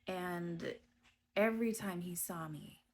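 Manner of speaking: normal talking voice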